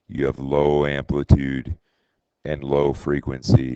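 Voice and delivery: low, even demonstration voice. Shown as a demonstration. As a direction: keep the pitch well down. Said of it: "in a low voice".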